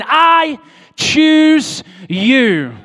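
fervent drawn-out declaration; loud proclamation. Acted. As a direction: belt out each word, I choose you. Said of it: "with the same tone of voice".

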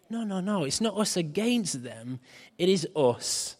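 normal talking voice

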